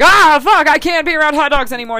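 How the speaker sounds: high, shouted wail of despair; loud mock freak-out, rushing after the outburst; hammed-up anguish. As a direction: cry out as if melodramatically traumatized. Acted traumatized [name] fleeing.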